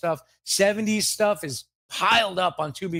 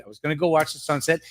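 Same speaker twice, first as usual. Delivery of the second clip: ordinary delivery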